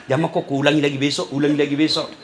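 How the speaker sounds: lightly voiced devil, low and soft; a hint of a sly tempter; tempting devil. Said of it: "goda ki setan".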